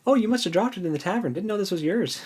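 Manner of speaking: high, quick, mock-innocent thief voice; innocent Tass. Cohost voicing Tass handing back the ring, high and innocent. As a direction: play Tass feigning surprise, airy and fast.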